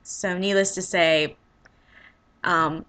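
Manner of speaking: normal talking voice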